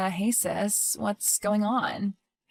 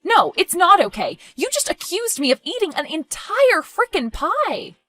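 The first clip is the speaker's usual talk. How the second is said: high, heated protest; offended and worked up; indignant outburst; 7 semitones higher than usual. Acted. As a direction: throw the accusation back, pitch raised. Sister rejects apology in quarrel.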